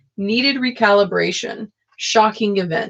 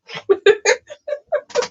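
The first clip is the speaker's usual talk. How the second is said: a high, breathy giggle. Real, not acted. Reader laughing at her own mix-up with a high giggle.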